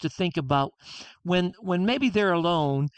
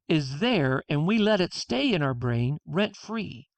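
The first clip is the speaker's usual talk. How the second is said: ordinary talk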